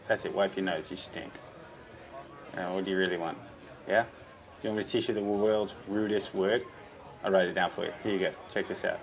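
normal talking voice

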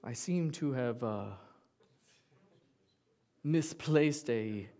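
slow, overly formal "Father" voice; mock-stuffy, drawn-out request; stiff formal son. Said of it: "I don't pick it up and be like".

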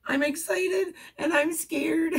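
half crying it out; telling mom on the phone, squeaky and teary. Retold or imitated. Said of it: "all squeaky in my voice".

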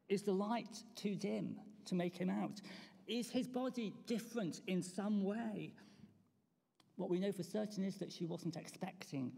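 usual speaking voice

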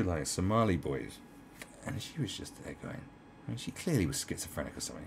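ordinary talk, nothing marked

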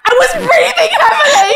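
high, loud shriek of laughter; losing composure. Real, not acted. Host reading the letter aloud, losing composure in shrieking laughter. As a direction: read it while exploding with laughter. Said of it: "Fucking compose yourself".

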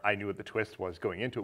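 usual speaking voice

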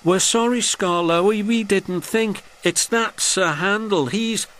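high-pitched, pleading little voices; apologetic coaches. A narrator's reading. Coaches blaming Sir Handel to the old engine, high apologetic voice. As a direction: make excuses in a high voice.

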